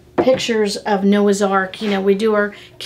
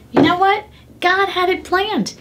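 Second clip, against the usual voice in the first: excited; 9 semitones higher than usual. Real, not acted. Excited revelation in sermon.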